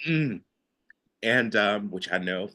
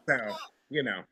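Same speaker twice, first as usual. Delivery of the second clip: usual speaking voice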